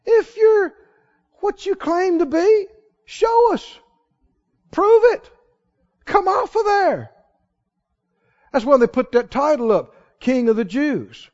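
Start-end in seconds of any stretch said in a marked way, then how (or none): 0.0-7.1 high taunting jeer; high-pitched scornful dare; mocking taunt. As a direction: goad him to prove it. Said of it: "And they're mocking him".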